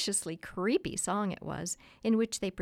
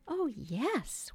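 high-pitched, prim little yes; 7 semitones higher than usual. A narrator's reading. Wife to husband on their make-believe, prim. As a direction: answer primly, pitched well up. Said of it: "Wendy said primly".

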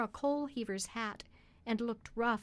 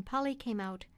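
plain reading voice

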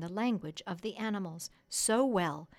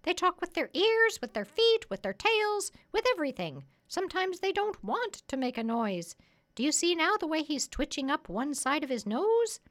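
high, loud parrot voice; 9 semitones higher, 5 dB louder than usual. Acted. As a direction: pitch it up high like a lecturing parrot.

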